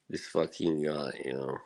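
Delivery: ordinary delivery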